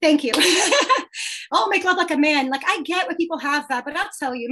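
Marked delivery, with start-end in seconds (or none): 0.4-2.5 laughing through the song title; amused, words riding on a laugh. Real, not acted. Guest repeats the song title to the host, laughing.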